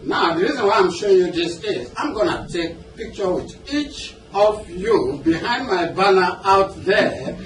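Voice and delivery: usual speaking voice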